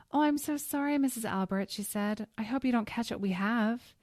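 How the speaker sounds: high, loud mock sweetness; sweetly insincere sorry; fake apology.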